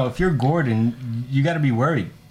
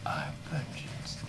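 whispered echo of a brooding hero; slow, hushed superhero impression; Batman; 16 dB quieter, slower than usual. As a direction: whisper it slowly, barely any voice. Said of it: "how he introduced himself".

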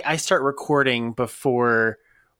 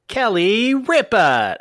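playful call-out; 8 semitones higher, 5 dB louder, much slower than usual. Pretend. Playful riff on the word rip.

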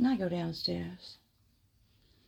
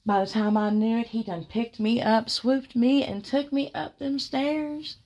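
fast, louder and rising higher; excited fast retelling, climbing higher; excited rush; 5 semitones higher, 7 dB louder than usual. Real, not acted. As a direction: tumble through it breathless with thrill. Swept off her feet, excited.

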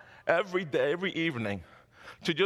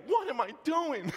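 incredulous inner voice; 7 semitones higher than usual. Retold or imitated. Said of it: "I'm even thinking".

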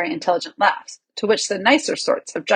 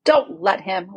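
ordinary delivery

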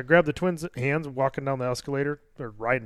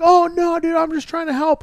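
much higher, louder flustered voice; defensive stranger; 13 semitones higher, 6 dB louder than usual. Retold or imitated. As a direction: play the startled guy backing off. Stranger defends himself, retold.

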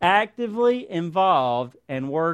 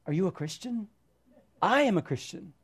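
put-on African accent; 7 dB quieter than usual. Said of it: "He kinda said it in an African accent".

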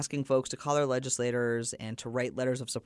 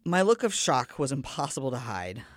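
normal talking voice

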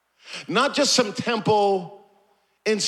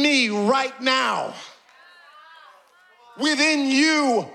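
high, impassioned sermon cry; preaching at full force; 6 semitones higher than usual. Real, not acted. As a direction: drive it home, stretching the repeated words.

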